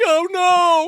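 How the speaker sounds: high, hammed-up cry of horror; mock dismay. Imagining living with an accidental stabbing, mock dismay. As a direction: wail it in exaggerated regret. Imagining the horrified killer's reaction.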